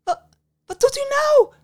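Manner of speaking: stammering, then very high shout; splutter, then shriek in disbelief; distraught cry. A narrator's reading. Young woman seeing her hair thrown in flames, distraught cry. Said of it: "de tranen in haar ogen".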